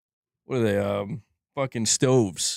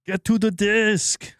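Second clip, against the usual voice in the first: put-on higher, slow Arnold Schwarzenegger accent; Arnold impression; 7 semitones higher, slower than usual. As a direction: bark it like Arnold in Predator. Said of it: "Imagine the movie Predator".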